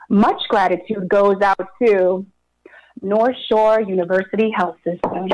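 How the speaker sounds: usual speaking voice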